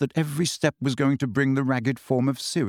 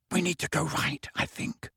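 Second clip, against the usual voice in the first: hushed, fading into a whisper; 4 semitones higher, 6 dB quieter than usual. A narrator's reading. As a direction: keep it barely audible. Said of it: "whispered Hermione".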